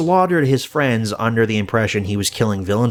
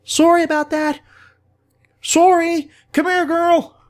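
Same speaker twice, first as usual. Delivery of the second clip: put-on much higher, sing-song overdone Canadian accent; chirpy apologetic Canadian accent, way overdone. Nice Pool apologizing and calling his dog, overdone Canadian accent. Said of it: "overemphasizes that Canadian accent".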